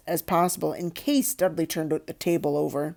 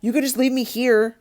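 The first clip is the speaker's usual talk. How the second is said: hopefully; 6 semitones higher, 5 dB louder than usual. A narrator's reading. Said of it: "Harry put in hopefully".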